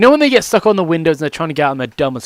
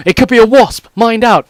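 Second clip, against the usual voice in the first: alarmed brain; 6 semitones higher, 6 dB louder than usual. Retold or imitated. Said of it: "my brain's like".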